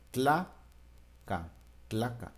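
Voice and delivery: tla, then ca, then tlaca, slowly and separately; sounded-out syllables. Shown as a demonstration. Teacher to class, sounding out the syllables of tlaca. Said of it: "si se dan cuenta".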